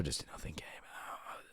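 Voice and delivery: breath only, almost no sound; failed whisper. Shown as a demonstration. Showing viewers his whisper failing, voiceless and faint. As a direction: try to whisper and have nothing come out. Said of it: "If I tried to whisper".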